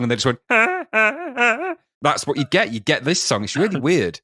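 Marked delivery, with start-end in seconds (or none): 0.5-1.7 high honked "ah" like a bicycle horn; honking horn singing. Retold or imitated. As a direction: honk each "ah" like a squeezed horn. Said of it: "those bicycle honking horns".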